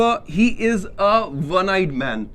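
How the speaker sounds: one eyed man said very slowly with a w start; slow example sentence. Shown as a demonstration. Teacher to students, slow model sentence. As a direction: say it word by word. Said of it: "क्योंकि व का sound है".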